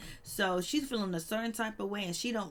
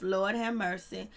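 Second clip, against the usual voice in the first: ordinary talk, nothing marked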